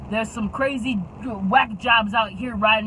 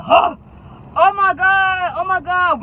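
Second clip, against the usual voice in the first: shocked; 7 semitones higher, 6 dB louder than usual. Retold or imitated. Shocked passengers after swerve.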